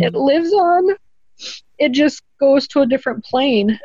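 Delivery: fighting back tears as the words come out; tearful. Real, not acted. Widow telling the host her soul lives on, choking up. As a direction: voice breaking high into real tears.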